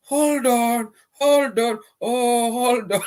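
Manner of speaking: squeaky high playful hold on, drawn out; high mock hold on. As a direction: pitch way up and tease them to wait.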